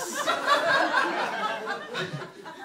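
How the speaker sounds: a sudden shrill, high-pitched burst of laughter; squealing laugh reenacted for the audience; imitated high laugh. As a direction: recreate the sound he couldn't forget, high and piercing. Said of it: "replaying that sound in my head".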